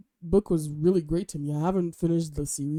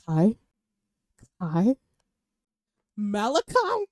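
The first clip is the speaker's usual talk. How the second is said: swooning; 3 semitones higher, slower than usual. Real, not acted. Fan swooning to her viewers over Kai, rising giddy calls.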